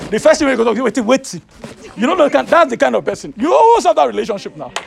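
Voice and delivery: loud, fervent pulpit voice; full-force preaching. Real, not acted. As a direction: preach it to the crowd.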